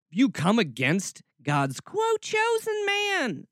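mock preacher voice jumping very high; hammed-up scoffing quote; mocking incredulity. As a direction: stretch the quoted words into a high, derisive wail. Mocks the quoted curse in disbelief.

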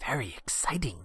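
half-whispered playful mimicry; teasing echo. Teasing echo of co-host's words.

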